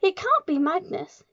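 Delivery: high, loud, disbelieving exclamation; horrified cry. A narrator's reading. Trunchbull denies that it is Magnus, crying out. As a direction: refuse to believe it, aghast. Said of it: "she cried".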